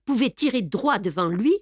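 usual speaking voice

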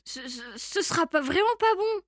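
stammering start, rising in pitch; stutter, then protest to save yourself; flustered little mouse; nervous mouse. Acted.

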